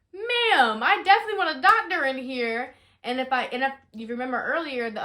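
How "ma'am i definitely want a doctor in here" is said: higher, louder insistent plea; retold loud plea to the nurse; urgent plea. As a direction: demand a doctor, voice rising in alarm.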